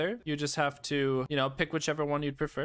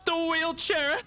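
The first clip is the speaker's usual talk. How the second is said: punchline shout; 14 semitones higher, 4 dB louder, slower than usual. Acted. Shouted punchline of own joke.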